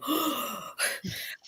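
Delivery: sharp gasp for air; demo gasp. Shown as a demonstration. Reader at chapter end gasping for air, sharp gasp. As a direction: gulp in a quick breath between chapters. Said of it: "when you go".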